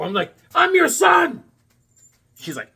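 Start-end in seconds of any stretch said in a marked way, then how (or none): 0.5-1.4 much higher, louder insistent reply; exasperated shout. Retold or imitated. Son telling his confused mother who he is, shouted. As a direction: shout it to make her understand.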